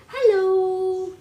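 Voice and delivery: high, loud hello with a long stretched O; imitating Aunt Renee. Mom copying how Aunt Renee greeted the baby, stretched-out hello. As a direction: sing out "Hello." with lots of O's. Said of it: "Like that, with a lot of O's".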